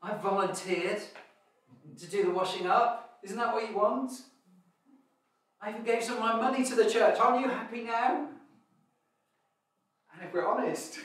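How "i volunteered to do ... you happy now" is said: higher, louder, peevish voice; grudging churchgoer. Retold or imitated. Churchgoer telling God they did their duty, peevish and grudging. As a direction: list good deeds like a sulky dutiful child. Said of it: "people are like".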